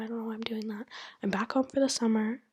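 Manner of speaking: ordinary talk, nothing marked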